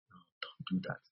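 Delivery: whispered warning; hushed aside. Real, not acted. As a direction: drop to a near whisper.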